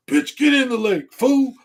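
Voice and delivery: high, loud mock yell to get in; hyped-up dare. To himself on the shore, high loud mock dare. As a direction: bark it like a rowdy friend. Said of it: "I go".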